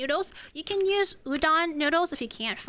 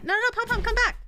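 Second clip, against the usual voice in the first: high, louder urgent call; calling the dog back; 10 semitones higher, 4 dB louder, faster than usual. Real, not acted. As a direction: call her back before she runs off. Dog runs off.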